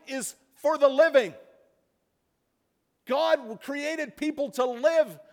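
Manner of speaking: high, loud preaching building to a shout; impassioned preaching. Acted. Preacher crying out about death and despair, full force. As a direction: lift the congregation with rising fervor.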